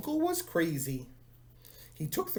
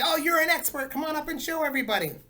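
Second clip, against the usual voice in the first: cheerful teacher invite; 10 semitones higher, 4 dB louder, faster than usual. Retold or imitated. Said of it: "Sometimes I'll say".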